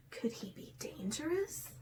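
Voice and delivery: hushed suspense whisper. Real, not acted. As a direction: breathe it like a secret. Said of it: "whispered suspense aside".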